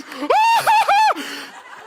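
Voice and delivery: high, loud scream of fear; acted-out frightened shriek; terrified wail. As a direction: let out a panicked, wailing cry. Terrified son hears mother scream.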